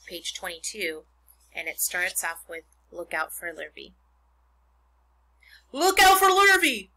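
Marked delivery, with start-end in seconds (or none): 5.7-6.9 loud, high warning shout; crying out. A narrator's reading. Cows warn the pig that Lurvy is coming, crying out. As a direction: holler it like a barnyard alarm. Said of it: "cried the cows".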